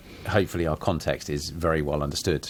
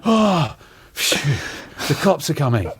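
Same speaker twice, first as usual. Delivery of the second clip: big sigh of relief, pitched high; louder, higher, hammed-up relief; relieved; 8 semitones higher, 5 dB louder, slower than usual. Shown as a demonstration. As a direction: rescue is here, thank goodness. Demonstrates relieved reading of the phrase.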